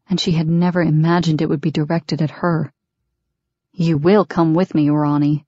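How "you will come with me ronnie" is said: plain reading voice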